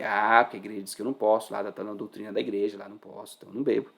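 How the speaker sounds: low, trailing-off mock excuse; grudging rule-follower. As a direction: recite the excuse quickly, dropping away. Mimics a rule-bound believer.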